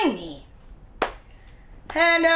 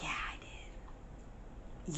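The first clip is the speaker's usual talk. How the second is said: slow, breathy whispered yeah; sheepish slow whisper; whispered reply; 22 dB quieter, much slower than usual. Retold or imitated. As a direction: barely voice it, slowly. Teen quietly answering the officer.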